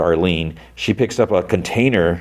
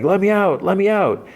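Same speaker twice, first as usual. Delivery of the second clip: retold yelling; 13 semitones higher than usual. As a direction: imitate a trapped kid pleading to escape. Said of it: "he starts yelling".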